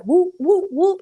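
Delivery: high whoops miming punches; imitated fight noises. Storyteller acting out her punches, imitated whooping blows.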